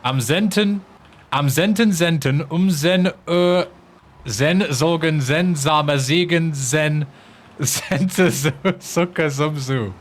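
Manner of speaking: German tongue twister, restarted and pushed through; attempted German. Shown as a demonstration. Streamer giving best attempt at German tongue twister, winged German. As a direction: give the German your best shot. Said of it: "That's the best you're gonna get".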